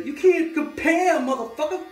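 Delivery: very high, stretched-out cry; hammed-up high-pitched protest; mock outraged wail. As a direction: wail it slowly at the top of your voice. Mock American rant after singing.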